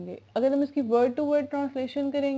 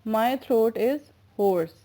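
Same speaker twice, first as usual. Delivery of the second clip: slow dictation; much slower than usual. Shown as a demonstration. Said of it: "English में जब हम इसको translate करेंगे".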